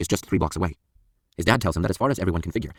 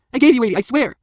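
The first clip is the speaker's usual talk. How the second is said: very high, loud pleading; desperate protest; 8 dB louder than usual. A narrator's reading. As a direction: swear innocence in a panic. Floored man protests innocence.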